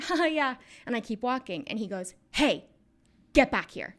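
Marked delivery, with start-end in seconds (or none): 0.0-0.5 high, louder fake little laugh; forced ha-ha, pitched up; nervous brush-off. Child brushing off intimidating man. 2.3-4.0 loud, commanding summons; imitating a stern order, raised voice; retold calling back. Man calls child back from stairs.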